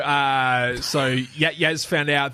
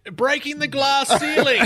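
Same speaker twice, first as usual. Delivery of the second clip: high, slow, laughing yell; yelled reply; 12 semitones higher, 4 dB louder than usual. Retold or imitated. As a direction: call it back over the crowd. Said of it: "I yelled back".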